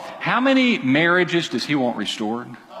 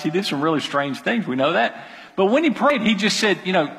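normal talking voice

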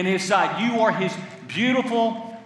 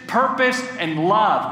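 normal talking voice

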